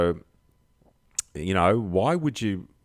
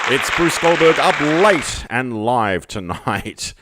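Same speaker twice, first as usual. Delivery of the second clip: higher, louder, slow show-host greeting; host hype opener; 6 semitones higher, 9 dB louder, slower than usual. Acted. Late-night host opening his show, loud and slow.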